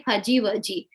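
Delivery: fuzzy wuzzy said as fudgy wudgy; wrong J sound. Retold or imitated. Teacher imitates how students mispronounce it. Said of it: "They say".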